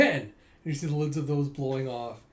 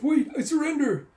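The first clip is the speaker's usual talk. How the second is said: high, loud cry; panicked surrender; 12 semitones higher, 7 dB louder than usual. Retold or imitated. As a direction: plead in panic. Bandit surrendering.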